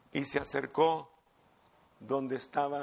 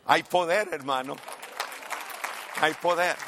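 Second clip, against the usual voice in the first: preaching proclamation. Real, not acted. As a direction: proclaim it to the congregation. Preacher proclaims God's power. (proclamación fuerte)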